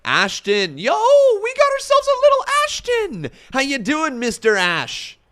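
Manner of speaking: high, loud excited hello; excited greeting. Real, not acted. Streamer greets viewer Ashton in chat, loud and high excited.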